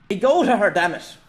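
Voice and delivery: high, loud urgent roar. A narrator's reading. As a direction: roar it out at a companion, desperate to be freed. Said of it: "Jem roared".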